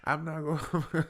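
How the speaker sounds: high, flustered mock protest; indignant customer. Customer disputing the charge with driver, indignant.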